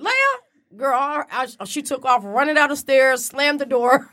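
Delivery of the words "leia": high startled call; high, uneasy name. Retold or imitated. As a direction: call out unsure. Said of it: "I'm like".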